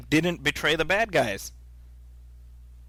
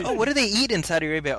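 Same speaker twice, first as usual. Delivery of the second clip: higher, quick curious question; imitated curious questioner; 7 semitones higher, 4 dB louder, faster than usual. People asking about Saudi Arabia, quick and curious. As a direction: ask it fast, all eager curiosity. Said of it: "Like, like".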